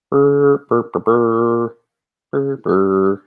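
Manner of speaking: slow playful lip trill while thinking; idle motorboat lips, slowing down. Real, not acted. Humming while scanning a list.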